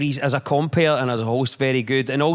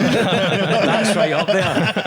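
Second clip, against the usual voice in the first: laughing; 6 semitones higher, 3 dB louder, much slower than usual. Real, not acted. Laughing at Mel Brooks story.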